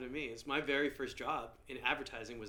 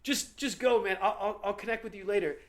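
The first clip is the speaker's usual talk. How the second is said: high, hurried shooing; telling a drunk friend to leave; urging friend away; 7 semitones higher, 5 dB louder than usual. Retold or imitated. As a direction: push him off gently but urgently. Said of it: "And then I go".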